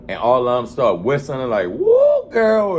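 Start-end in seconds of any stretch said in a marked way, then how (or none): 1.7-2.8 very high, loud whoop; mock crowd whoop, high and loud; cheering woo. Women whistling and cheering.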